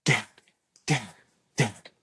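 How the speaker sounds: normal talking voice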